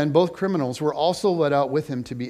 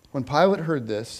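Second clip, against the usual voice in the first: ordinary delivery, nothing marked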